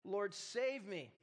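slow desperate plea for rescue; crying out. A narrator's reading. Peter sinking in the water calls to the Lord, slow desperate plea. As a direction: drag it out, pleading to be saved. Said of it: "cried out".

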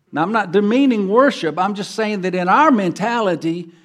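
loud, driving sermon delivery; full-force preaching. Acted. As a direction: preach it out to the whole congregation.

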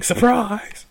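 high, loud yell; screamed surprise. Acted. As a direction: shout it like a sudden ambush.

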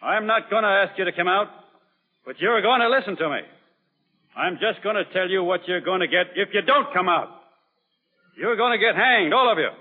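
stern harangue. Acted. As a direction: lay down the law to defiant men.

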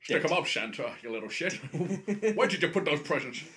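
loud, comic tough-guy threat; mock interrogation. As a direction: raise it into a hammed-up demand. Acting out Bond confronting Santa.

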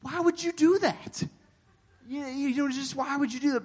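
baffled disbelief. Real, not acted. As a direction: ask it as if it makes no sense. Baffled at throwing jellyfish.

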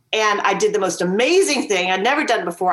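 ordinary talk, nothing marked

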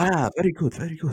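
hammed-up impressed native speaker; mock praise. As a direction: pat them on the head with it. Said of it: "they go".

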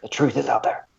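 hushed, mysterious TV-show line; mock eerie narrator. Quoting the sci-fi show about Nessie, hushed and eerie. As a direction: play it like a spooky sci-fi tagline. X-Files quote joke.

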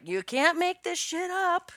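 playful high quoting voice; mock chorus voice. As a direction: voice it like the whole group saying it together. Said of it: "everyone always says, like".

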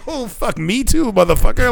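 high, ironic agreement; high mock enthusiasm; mock joining in. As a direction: play along with the old man. Mock agreement to dirty joke.

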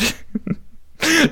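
high giggle at his own silly voice; laughing it off; real laugh. Real, not acted. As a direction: burst out laughing at yourself.

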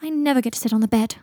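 mildly aggrieved grumble; a touch of petulant protest; complaining. A narrator's reading. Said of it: "complained Daphne".

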